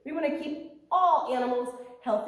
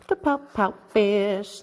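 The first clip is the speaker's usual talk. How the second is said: slow, loud title announcement; title read aloud; 8 dB louder, slower than usual. Shown as a demonstration. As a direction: present the book with a flourish. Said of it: "This is one of my favorites".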